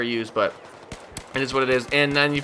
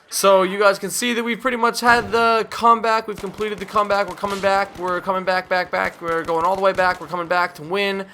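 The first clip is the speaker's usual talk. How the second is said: excited; 7 semitones higher than usual. Real, not acted. Celebrating the comeback.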